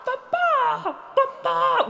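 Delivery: very high two-note bird squawk; piercing imitation of a peacock cry; peacock call. Shown as a demonstration. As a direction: shriek it like a peacock. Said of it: "the sound of the peacock".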